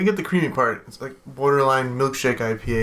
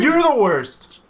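mock retort; 8 semitones higher, 4 dB louder, much slower than usual. Mock retort to a viewer's insult.

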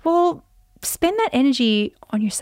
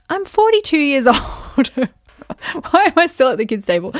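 laughing mock protest; 5 semitones higher, 4 dB louder than usual. Adult protesting kids' table, laughing.

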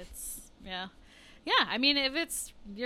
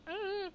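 goofy grunt; 11 semitones higher, 5 dB quieter than usual. Retold or imitated. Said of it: "making faces like".

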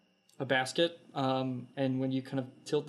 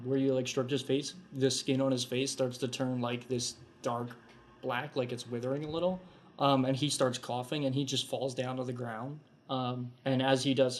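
ordinary talk

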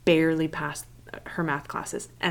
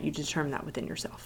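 whispered; 2 semitones lower, 9 dB quieter, faster than usual. Real, not acted. Sudden whispered point.

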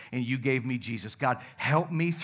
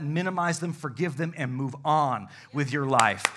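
ordinary delivery

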